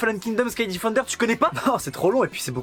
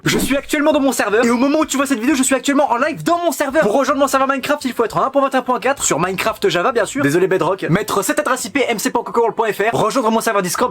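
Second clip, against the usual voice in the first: annonce promo tonitruante et rapide; pub criée; 2 semitones lower, 5 dB louder than usual. Acted. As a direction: vends ton serveur à pleins poumons. Pub pour son serveur. (réclame criée pour le serveur Minecraft)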